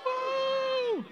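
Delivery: high-pitched whoop to show the cheer; high woo cheer. Shown as a demonstration. Showing the kids how to cheer, a high woo. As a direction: yelp a bright cheering woo. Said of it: "kinda react to that".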